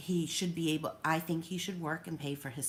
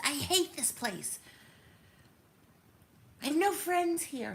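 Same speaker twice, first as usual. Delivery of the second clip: higher-pitched imitation of a resentful teenager; imitating son's whiny rant; 9 semitones higher than usual. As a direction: voice the sulky teen's higher whine. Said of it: "he'll scream at the top of his lungs".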